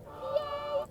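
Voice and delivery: a high, bright little cheer; cheering yay. Real, not acted. Vlogger cheering that the channel will be in English, high excited yay. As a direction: squeal it out happily.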